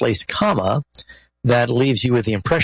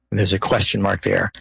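ordinary delivery

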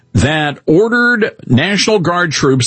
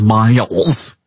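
mock Michigan accent on "Miles"; "Miles" in a playful Michigan accent; 5 semitones lower than usual. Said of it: "that's my Michigan accent".

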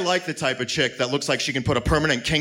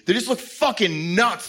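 ordinary talk

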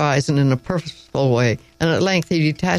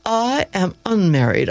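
prim, dignified correction; with dignity; 4 semitones higher than usual. A narrator's reading. Teacher correcting the park keeper, prim and dignified. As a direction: draw yourself up and correct him. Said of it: "I said with dignity".

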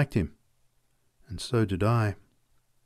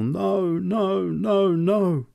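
terrible cry; 7 semitones higher, much slower than usual. A narrator's reading. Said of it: "his terrible".

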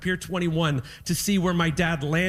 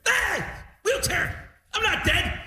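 high, squawking indignant dad; mock outrage; 11 semitones higher, slower than usual. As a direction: protest as a wildly offended old father. Offended dad in acted quarrel.

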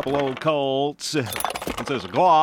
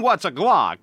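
normal talking voice

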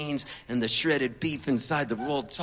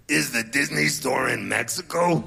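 put-on singer's voice, louder; 2 semitones lower, 5 dB louder than usual. Passenger questioning the driver near Tijuana, loud imitated voice. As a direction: ask it loudly in his imitated voice. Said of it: "[name] goes to me".

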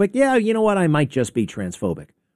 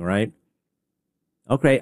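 ordinary talk

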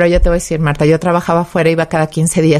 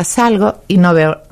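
ordinary talk, nothing marked

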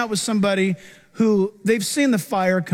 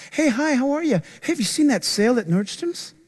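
shallow small talk; 5 semitones higher than usual. Retold or imitated. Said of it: "This is how they talk".

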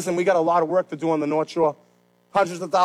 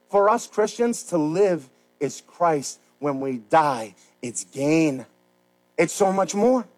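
usual speaking voice

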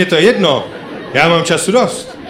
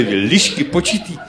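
ordinary talk

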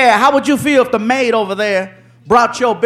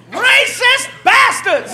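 high, drawn-out indignant cry; outraged cry; 12 semitones higher, much slower than usual. Retold or imitated. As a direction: wail the insult slowly, way up high. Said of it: "Next thing we hear outside the door".

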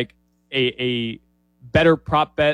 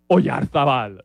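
Spanish accent; slower than usual. Real, not acted. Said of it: "I'm saying it with a Spanish accent".

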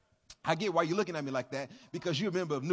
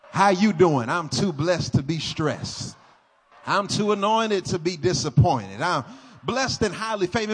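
loud, hammed-up churchy cheerfulness; 7 dB louder than usual. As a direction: parody the too-blessed greeting.

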